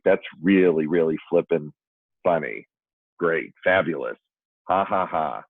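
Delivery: dry, spaced-out mock appreciation; retold sarcasm. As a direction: fake a laugh word by word, unimpressed. Sarcastic reply to wife's pickle joke.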